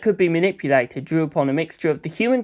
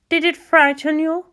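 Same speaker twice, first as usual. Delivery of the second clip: council elder; 12 semitones higher than usual. Acted. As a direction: probe gently and gravely.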